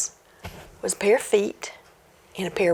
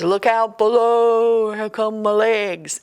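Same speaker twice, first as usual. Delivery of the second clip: louder, slow ghostly call; ghost voice from the chimney; 4 dB louder, slower than usual. Acted. Ghost calls down the chimney to Jack, slow and booming. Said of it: "the voice says".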